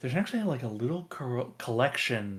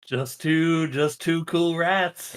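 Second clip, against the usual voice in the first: higher, louder, slowed delight; 5 semitones higher, 6 dB louder, slower than usual. Acted. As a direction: savour the words, pleased. GM cheers the rat pair.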